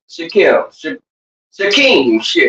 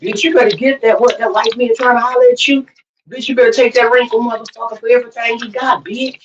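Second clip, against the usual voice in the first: higher-pitched, sassy egging-on of a friend; higher mock gossip voice, urging a friend on; 4 semitones higher, faster than usual. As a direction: do their catty "bitch, you better" voice. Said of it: "That's how they say it, y'all".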